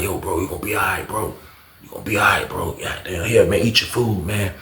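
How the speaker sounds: hushed reassurance, arm around him; comforting. Retold or imitated. D C comforting Ron.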